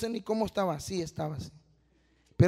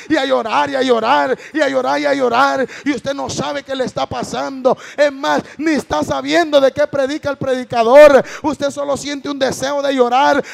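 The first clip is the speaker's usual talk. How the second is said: loud, raised, impassioned sermon voice; full-force preaching; 4 semitones higher, 10 dB louder than usual. Acted. Testifying to the congregation about a broken heart, loud fervent preaching. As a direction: build to a shouted peak.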